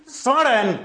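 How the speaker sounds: high, punchy victory shout; triumphant cheer. Retold or imitated. Defender cheering his tackle on the opponent. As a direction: celebrate the hard tackle.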